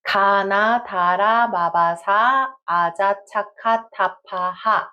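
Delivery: "Kana, tara, babasa" said clearly, one syllable at a time; measured teacher drill; syllable pattern drill. Shown as a demonstration. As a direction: model each pattern for students to echo. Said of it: "So listen and repeat".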